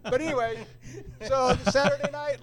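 laughing. Real, not acted. Laughs while resuming story.

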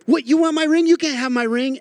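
high, loud possessive whine; agitated Bilbo. Retold or imitated. Bilbo snapping at Gandalf over the ring, high and loud. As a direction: clutch the ring, shrill and defensive.

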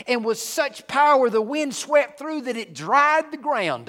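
reading the parting of the sea with rising power; dramatic scripture reading. Real, not acted. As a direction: build the miracle reading to a climax. Dramatic Bible reading building.

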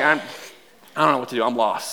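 muttered defeat. Retold or imitated. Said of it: "I'm like".

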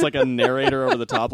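usual speaking voice

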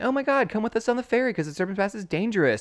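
high, fast mock-urgent warning; urgent refugee. Recapper plays refugee warning the gang, mock-urgent. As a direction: gush it out high and hurried. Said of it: "He's like".

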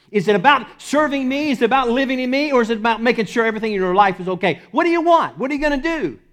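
high, loud, pressing questions; challenging voice of Jesus. Retold or imitated. Preacher voicing Jesus challenging the church, urgent and loud. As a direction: press them hard as Jesus would.

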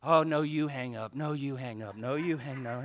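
soft, playful sweetheart voice on the phone; mock lovesick whisper to his girlfriend; lovestruck phone talk. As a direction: coo it like a young couple who won't hang up. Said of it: "talking to the Queen Colleen on the phone".

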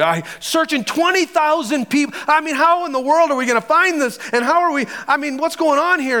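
high, incredulous protest; exasperated disbelief. Acted. Disciples told to feed twenty thousand people, exasperated disbelief. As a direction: pitch it up in exasperated questions.

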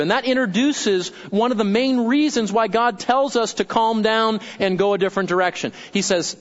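ordinary talk

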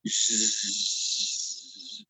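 long, soft electric buzz; imitated door buzzer. Rabbi mimics the prison door opening, drawn-out buzz. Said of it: "you really hear it".

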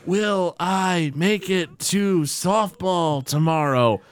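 slow, drawn-out weak voice; mock feeble patient; very slow speech. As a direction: drag each word out like a patient. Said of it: "can't ... speak at a normal rate".

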